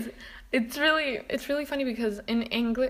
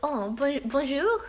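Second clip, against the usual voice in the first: put-on giggly, higher-pitched French-accented greeting; 5 semitones higher, slower than usual. Customer greets French shop staff, in a giggly French accent. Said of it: "French, French accent".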